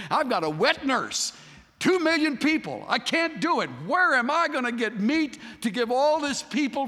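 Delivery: high, loud, overwhelmed complaint; exasperated outcry. Acted. Moses crying out to God about the people, exasperated loud. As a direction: throw up your hands and cry out.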